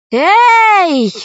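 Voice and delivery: loud high call down a well; shout. Acted. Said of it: "крикнула Алиса вниз".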